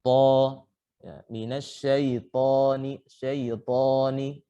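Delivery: minasyaitoni slow with a thick to and open lips; heavy to demo. Shown as a demonstration. (minasyaitoni dibaca pelan, to tebal dengan bibir terbuka)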